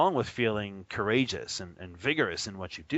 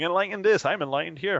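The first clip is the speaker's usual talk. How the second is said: mocking boast; 7 semitones higher, 5 dB louder than usual. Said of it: "no one would be sitting there going".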